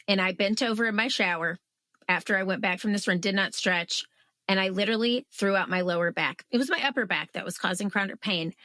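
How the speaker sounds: ordinary talk, nothing marked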